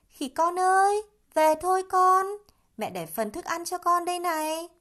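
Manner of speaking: high, loud calling voice of a mother monkey. A narrator's reading. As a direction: call your child home across the forest. Mother calling her child home. (mẹ khỉ gọi con, giọng cao và to)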